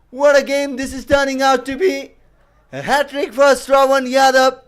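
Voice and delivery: high, loud hat-trick call; excited commentator. Real, not acted. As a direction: scream the wicket with joy. Hat-trick wicket called live.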